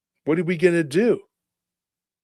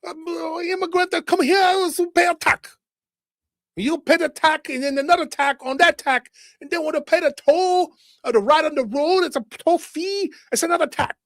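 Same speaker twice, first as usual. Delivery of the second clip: much higher, far-off squeaky voice; high mocking voice; 12 semitones higher, 5 dB louder than usual. And then the other one.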